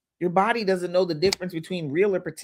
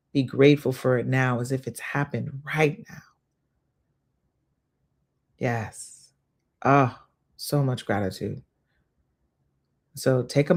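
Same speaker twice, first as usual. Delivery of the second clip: unvoiced gentle whisper; 6 semitones lower than usual. Host whispering the gratitude cue to listeners. Guiding a visualization.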